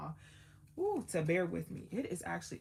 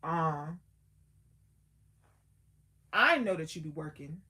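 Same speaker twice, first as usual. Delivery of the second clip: louder, stretching out show these folks; Jesus praying to the Father; 3 semitones higher, 7 dB louder than usual. Retold or imitated. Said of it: "He said".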